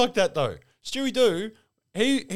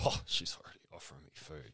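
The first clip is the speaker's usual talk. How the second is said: soft awkward mumble; lightly voiced awkward player; mock player; 6 semitones lower, 14 dB quieter than usual. Imitating the player visiting his nonna. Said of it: "he's like".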